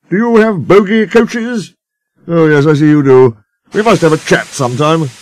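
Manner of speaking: pompous engine chatter; character voices. A narrator's reading.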